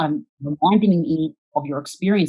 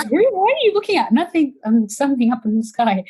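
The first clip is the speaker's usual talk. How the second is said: much higher, loud flustered defence; caught staring; 11 semitones higher, 6 dB louder than usual. Retold or imitated. Caught staring at strangers, answering in a high flustered yelp. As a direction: squeak it out like you got caught.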